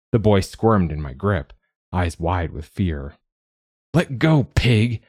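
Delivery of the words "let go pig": put-on a high, loud boy's demand, not the bass the text describes. Boy in a stranger's grip, yelling to be let go. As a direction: bark it out as a cornered kid. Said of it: "he demanded".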